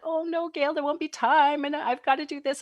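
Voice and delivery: high-pitched mock fretting; retold fretting. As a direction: imitate an overwhelmed colleague protesting. Retold overwhelmed teacher protesting.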